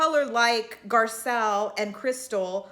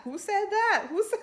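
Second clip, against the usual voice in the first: much higher, shocked dramatic demand; Housewives drama; 9 semitones higher than usual. Retold or imitated. Host imitates Atlanta housewives demanding who said it, high and dramatic. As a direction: camp outrage like a reality-show dinner table. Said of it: "That's what it reminded me of".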